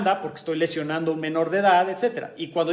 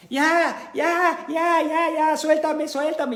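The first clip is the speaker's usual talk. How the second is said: much higher, pained cries to be released; imitated panicked yelling; 10 semitones higher, much slower than usual. Teacher imitating a student yelling in pain, high panicked cries. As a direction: squeal like someone in a joint lock. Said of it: "literalmente empezó a gritar".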